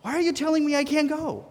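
high, hurt protest to God; complaining to God about being kept home, upset and high; upset pleading. Retold or imitated. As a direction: plead as if wronged. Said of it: "I kinda got upset about it".

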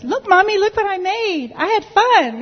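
put-on very high, singing-out child voice; excited little boy. Little boy running up to his mother, excited. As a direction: imitate a small child showing off to mom. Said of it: "her little boy runs up to her".

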